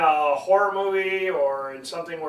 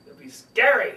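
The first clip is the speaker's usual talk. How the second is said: ordinary talk, nothing marked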